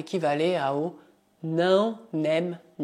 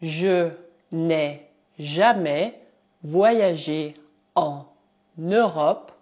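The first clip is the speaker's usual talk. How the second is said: "je n'ai jamais voyagé en Europe" said word by word; teacher dictating slowly; slow dictation; 3 dB louder, slower than usual. Shown as a demonstration.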